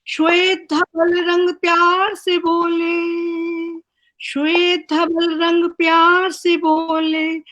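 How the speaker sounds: measured, sing-song recital with each line repeated; poem recitation. Acted. Poet reciting her tricolour poem to the online gathering, rhythmic recital. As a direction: lift the refrain like a patriotic anthem.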